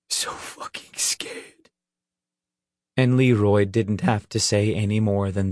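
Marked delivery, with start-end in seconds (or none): 0.1-1.7 slow, frightened whisper; barely a whisper. Acted. Leroy admitting his fear to Nick, whispering. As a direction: let the voice drop away to breath. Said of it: "barely a whisper".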